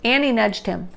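ordinary delivery

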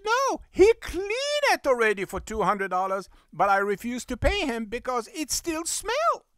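high, insistent retort; indignant arguing. Retold or imitated. Customer refuses to pay for smelly rug, high and indignant. Customer argues back about cleaning.

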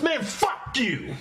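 drawn-out, high, exasperated fuck you; slow, exasperated brush-off; fed-up retort. Retold or imitated. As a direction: let the words drag out, completely done with him. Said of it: "I was, I was finished".

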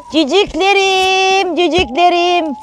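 high, slow, sing-song call to her chicks. Real, not acted. Calling her little chicks to show them, high and drawn out. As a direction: coo the word out long and high.

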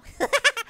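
short chuckle; laugh. Real, not acted. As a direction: laugh off your own joke. Laughs off joke about crying.

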